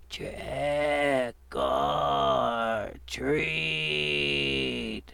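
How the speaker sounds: very slow, drawn-out raspy moan; raspy zombie moan. Acted. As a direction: groan it out like the undead. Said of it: "he moaned in a raspy voice".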